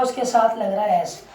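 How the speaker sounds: ordinary delivery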